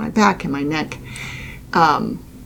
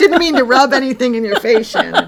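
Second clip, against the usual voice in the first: higher, louder, breaking into laughter; laughing apology; 6 semitones higher, 5 dB louder than usual. Real, not acted. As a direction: apologize through real laughter. Joking apology amid shared laughter.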